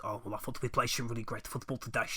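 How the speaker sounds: gruff Dutch manager in a post-match interview; Dutch accent. Retold or imitated. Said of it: "his little gruffly Dutch voice".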